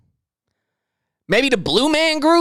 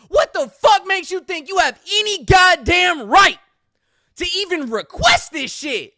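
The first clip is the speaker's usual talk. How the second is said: higher, yelling, disbelieving; furious rant; 6 semitones higher than usual. Real, not acted. Host yelling at the entitled rioter, furious rant. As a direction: lay into her hard.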